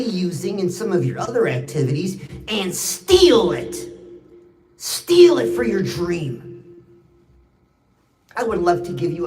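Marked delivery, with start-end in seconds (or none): 2.5-6.6 "steal" slow and heavy, louder; stress on steal. Real, not acted. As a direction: lean hard and slow on "steal". Driving home his key point.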